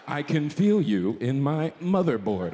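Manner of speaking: slow, low voice-assistant impression; measured machine come-on, word by word; Siri. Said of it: "Siri's out here like".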